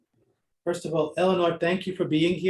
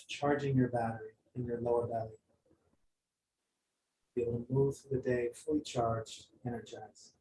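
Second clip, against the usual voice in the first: guided meditation; 4 semitones lower, 4 dB quieter than usual. Acted. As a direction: gently guide them back to the room.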